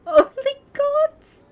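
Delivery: high, loud, slow crying laugh; real helpless cry-laugh; cry-laughing squeal. Real, not acted. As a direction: squeal it through tears of laughter.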